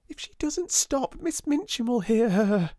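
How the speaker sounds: high, anxious schoolgirl plea; worried girl. A narrator's reading. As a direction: urge her to hush before they are caught. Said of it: "cried Jessie".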